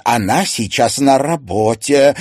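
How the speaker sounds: ordinary delivery, nothing marked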